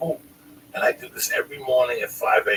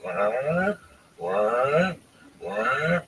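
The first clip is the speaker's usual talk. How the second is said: buzzer imitation; 3 semitones higher, much slower than usual. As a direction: mouth buzzer wah. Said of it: "And it's going".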